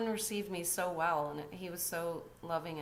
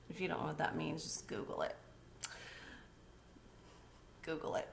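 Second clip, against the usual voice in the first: murmured aside; 7 dB quieter, faster than usual. Real, not acted. Aside to viewers, dropped voice.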